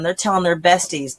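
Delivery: normal talking voice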